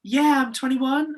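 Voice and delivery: high, slow breezy reply; young staffer answering lightly; chirpy young voice. Retold or imitated. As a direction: sound young and carefree.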